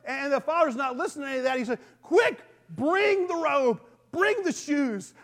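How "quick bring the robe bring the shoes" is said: eager father. Retold or imitated. Father welcomes lost son.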